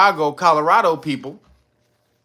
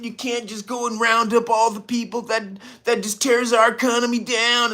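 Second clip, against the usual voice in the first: 1 mock politician voice; 6 semitones higher than usual. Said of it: "You like".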